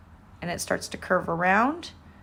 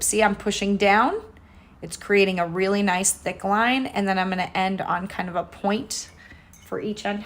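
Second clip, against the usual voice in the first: usual speaking voice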